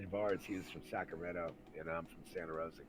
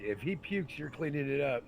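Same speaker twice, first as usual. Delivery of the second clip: retold cab driver's warning; 6 semitones higher, 6 dB louder than usual.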